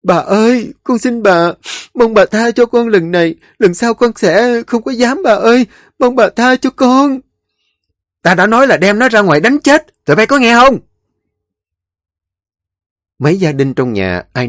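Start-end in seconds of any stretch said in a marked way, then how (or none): 0.0-7.2 high, loud pleading servant; terrified begging. A narrator's reading. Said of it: "lạy lục van xin bà Khải". 8.2-10.8 loud, high furious command; angry shout. A narrator's reading. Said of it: "bà nổi giận hét lớn".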